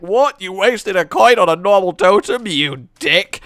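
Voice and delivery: loud, high, incredulous jeer; mock outrage; mock outraged opponent. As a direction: scoff at the wasted coin. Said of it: "people are like".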